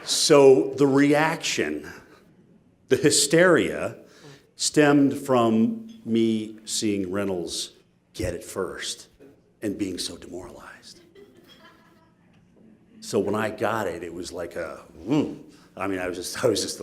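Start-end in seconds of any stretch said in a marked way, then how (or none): 0.0-11.0 slow recap. Real, not acted. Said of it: "slow emphatic recap".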